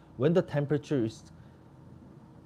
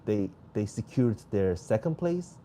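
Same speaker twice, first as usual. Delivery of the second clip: ordinary talk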